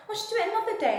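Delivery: high, sing-song mimicry; airy mock voice of well-meaning people; mocking others' advice. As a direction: parody the breezy advice-givers. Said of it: "everyone's always just like".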